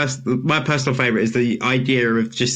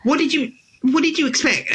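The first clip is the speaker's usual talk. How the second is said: much higher, incredulous mock protest; exasperated retort; 13 semitones higher than usual. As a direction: retell your exasperated comeback in a high voice. Exasperated retort to mum, retold.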